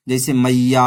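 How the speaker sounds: मैया called out loud with the last vowel held; calling out मैया. Shown as a demonstration. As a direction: stretch the final आ of मैया like calling to mother. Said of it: "तो यहाँ पर प्लुत स्वर है ना".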